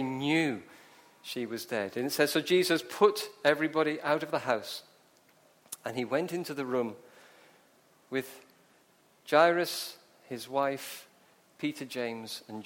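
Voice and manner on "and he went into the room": usual speaking voice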